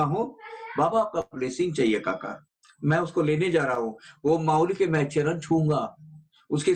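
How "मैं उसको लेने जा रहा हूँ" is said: normal talking voice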